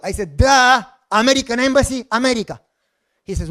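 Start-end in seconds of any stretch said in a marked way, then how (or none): 0.4-2.6 loud, higher, exasperated; exasperated answer. Retold or imitated. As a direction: shout the obvious at him.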